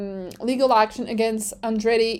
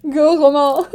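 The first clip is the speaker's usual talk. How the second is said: cheering him on; 3 semitones higher, 5 dB louder than usual. Pretend. Cheering on driver's lawsuit.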